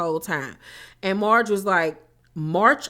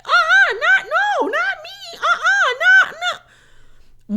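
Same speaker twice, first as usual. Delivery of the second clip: very high, loud, squeaky mock denial; mock Jackie denial; 6 dB louder than usual. Recapper mocking Jackie's frantic high-pitched denial. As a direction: play a panicked liar squealing no. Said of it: "So here go Jackie".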